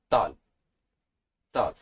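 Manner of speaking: "tall" with the T eased toward a D; relaxed T. Shown as a demonstration. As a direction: start "tall" on a sound between D and T. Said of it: "Sounds between D and T".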